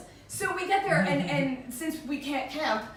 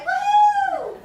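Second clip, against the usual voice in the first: much higher, louder woo; excited whoop; 11 dB louder than usual. Retold or imitated. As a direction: cheer like a thrill seeker. Cheering at rough waters.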